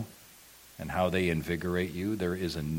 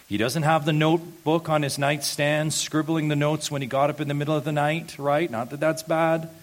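higher, louder climactic preaching; impassioned preaching; 7 semitones higher, 4 dB louder than usual. Acted. Pastor building to groaning as David's first thought, fervent preaching. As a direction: drive home the point with fervour.

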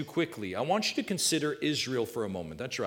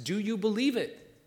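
normal talking voice

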